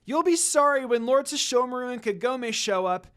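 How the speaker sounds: loud, high threat; shout. Acted. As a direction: warn the monk at the top of your voice. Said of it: "Rin shouted".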